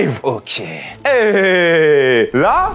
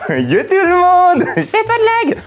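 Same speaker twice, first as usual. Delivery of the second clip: mock streamer greeting; 14 semitones higher, slower than usual. Mocking the rival streamer again.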